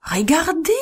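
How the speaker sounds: high, surprised call to look; mild storybook exclamation; excited cry. A narrator's reading. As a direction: point and cry out in wonder. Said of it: "cria quelqu'un".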